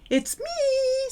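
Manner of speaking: high, long-held call; drawn-out announcement. Shown as a demonstration. Teacher acting out announcing oneself, high drawn-out call. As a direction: sing it out slowly and high. Calling out who it is.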